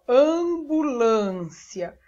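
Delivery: "ambulância" slow, with stretched nasal "am"; sounding out syllables. Shown as a demonstration. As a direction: draw out each syllable of "ambulância". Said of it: "Agora, observe". ("ambulância" bem devagar, silabado)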